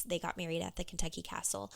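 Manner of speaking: normal talking voice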